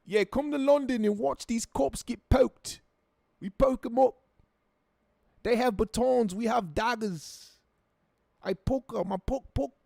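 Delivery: high-pitched hammed-up London accent; mock London accent. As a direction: quote it as an exaggerated London lad. Mock London stabbing quote.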